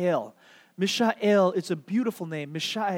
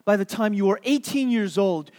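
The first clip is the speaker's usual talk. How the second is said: ordinary talk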